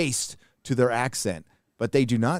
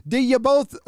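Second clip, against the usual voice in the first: mock Irish accent; 9 semitones higher, 3 dB louder than usual. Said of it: "deliver lines such as".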